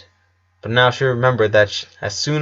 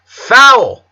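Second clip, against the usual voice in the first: sharp, loud, high shout from the teacher; yelled foul; 11 semitones higher, 8 dB louder than usual. A narrator's reading. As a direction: blast it like a referee's whistle call. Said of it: "yelled the teacher".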